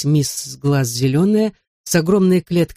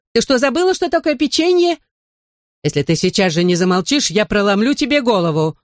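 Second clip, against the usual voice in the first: very high exasperated shouting; furious yell; 6 semitones higher than usual. Acted. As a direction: threaten the bird in a rage. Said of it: "вне себя заорала".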